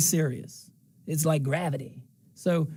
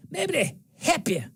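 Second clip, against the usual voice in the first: higher, Natchez Mississippi accent; 5 semitones higher than usual. Shown as a demonstration. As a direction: play up the Natchez accent for the hometown crowd. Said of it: "that's for my Natchez folks".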